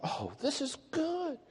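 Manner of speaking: high, drawn-out relief, softly said; delighted. Retold or imitated. Golfer thinking he has only one problem, delighted. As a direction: savour the good news, high and slow.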